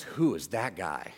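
put-on a stern older man demanding an answer; menacing old man.

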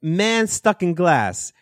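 high, slow mock Polish accent; botched Polish accent. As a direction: draw it out in a badly imitated Polish accent. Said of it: "I was doing a better accent of him earlier".

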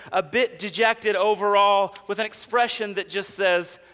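ordinary talk, nothing marked

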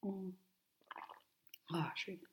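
soft sigh after a sip; breathy aah after drinking coffee; while sipping coffee. Real, not acted. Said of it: "ich muss mal kurz Kaffee trinken".

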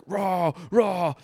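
mock alpha grunts; mock bro roar. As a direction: hype chant like a caveman bro. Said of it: "It's like".

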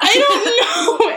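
high, slow, laughing through the words. Retold or imitated. Retelling her reply to the angry caller, laughing. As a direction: giggle out the answer. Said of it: "How do you take that?".

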